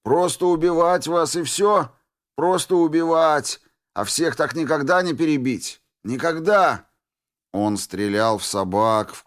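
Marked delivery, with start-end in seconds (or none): 0.0-6.9 shouting. Acted. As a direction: rage at the endless monsters. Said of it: "закричал дезертир монстром".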